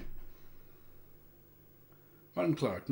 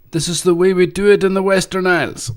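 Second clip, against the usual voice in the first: loud, high Western Isles island accent; 10 semitones higher, 21 dB louder than usual. Shown as a demonstration. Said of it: "Okay, can't resist".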